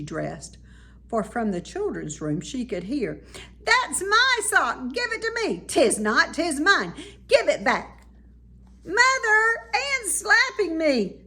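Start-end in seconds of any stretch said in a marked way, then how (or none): 3.7-8.1 put-on high, loud childish bickering; squabbling child. Twins quarreling over a sock. 8.8-11.2 high, loud whiny call to Mother; tattling wail. Acted.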